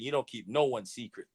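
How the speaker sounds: ordinary talk, nothing marked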